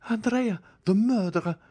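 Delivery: high, alarmed outcry; a lady's shocked high cry; horrified cry. A narrator's reading. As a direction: cry out the name in horror. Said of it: "cried one of the ladies".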